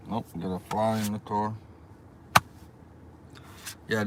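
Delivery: ordinary delivery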